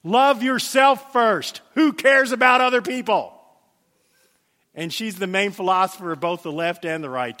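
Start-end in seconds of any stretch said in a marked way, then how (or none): 0.0-3.3 slow, loud sneering mock creed; loud mocking recitation; mocking quote. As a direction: sneer it out as a parody.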